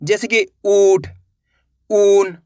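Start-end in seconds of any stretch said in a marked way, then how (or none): 0.7-1.1 "ऊठ" with a long, drawn-out ऊ; stretched vowel. Shown as a demonstration. Teacher giving an example word, "ऊठ" drawn out. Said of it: "खींच के पढ़ेंगे". 1.9-2.4 "ऊन" with a long, drawn-out ऊ; stretched vowel. Shown as a demonstration. Teacher giving an example word, "ऊन" drawn out. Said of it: "इस तरीके से खींच के पढ़ा जाता है".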